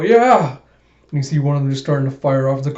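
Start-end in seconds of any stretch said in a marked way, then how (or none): 0.0-0.6 much higher, loud whoop; battle cry. Acted. Fighter cheers as guns come out, loud whoop. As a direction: yell it out, hungry for a fight.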